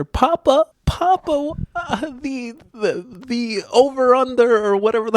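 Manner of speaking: high mock cry of papa; Eleven from Stranger Things. Echoing the co-host's Eleven, a high papa. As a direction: copy the girl calling for papa. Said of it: "Eleven from Stranger Things there".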